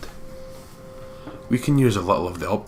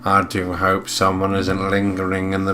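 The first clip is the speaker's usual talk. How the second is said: Filch; 5 dB louder, slower than usual. Acted. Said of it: "Filch raises up a lamp".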